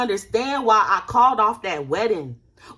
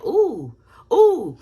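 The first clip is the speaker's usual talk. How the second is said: rising delighted ooh, much louder at the end; aha exclamation; 3 semitones higher, 5 dB louder than usual. Retold or imitated. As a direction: let the realization burst out. Imagined aha moment exclaimed.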